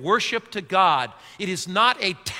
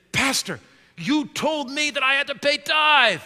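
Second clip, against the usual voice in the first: higher voice of the visiting man, a touch indignant; member's voice; 7 semitones higher than usual. Retold or imitated. Church member complaining to the pastor in a higher, indignant voice. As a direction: play the church member protesting.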